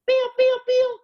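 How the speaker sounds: high, bright laser pews; playful pew sound. Pretend. Teacher playing for the class, high laser pews. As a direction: zap it out like a toy gun.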